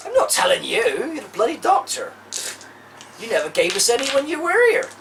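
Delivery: gruff complaint. Acted. Pirate complaining loudly.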